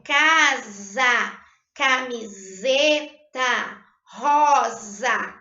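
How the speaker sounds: "casa", "camiseta", "rosa" with the S voiced as a buzzing Z; S sounding as Z. Shown as a demonstration. Teacher showing pupils the S between vowels, Z sound. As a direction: stretch "camiseta" and buzz the Z in each word. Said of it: "a letra S no meio de duas vogais".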